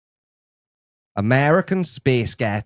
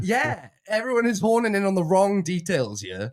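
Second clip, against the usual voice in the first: higher-pitched mock American; put-on American accent; 6 semitones higher than usual. Complaining of being ganged up on, mock American accent. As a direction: protest in an exaggerated American accent. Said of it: "Which American accent?".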